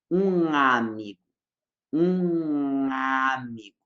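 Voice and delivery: slow um amigo with a nasal ng joining the words; nasal linking. Shown as a demonstration. Teacher showing Portuguese nasal link, slow pron. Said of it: "a gente faz um som nasal fanho".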